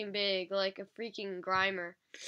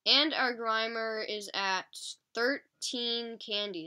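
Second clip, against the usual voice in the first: usual speaking voice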